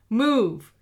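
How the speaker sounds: loud, clipped command; villain orders Lara at gunpoint; curt order. A narrator's reading. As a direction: snap it out. Villain shoves captive forward.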